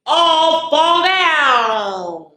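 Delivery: high, very slow, stretched-out falling cry; dramatic fall. Acted. Ending the rhyme for the kids, high and stretched out. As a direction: draw out the fall like you're toppling over. Children's game falling moment.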